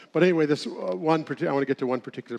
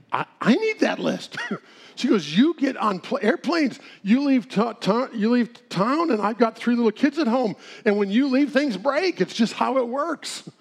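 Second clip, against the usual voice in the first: higher, insistent put-on voice; imitated wife; 8 semitones higher than usual. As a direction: voice the wife pressing her husband. Wife Sandy demanding the list.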